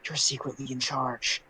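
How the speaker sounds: hushed retold aside, only half whispered; mock whisper. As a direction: imitate a secret whisper, voice dropped. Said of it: "and then whispers to Aim".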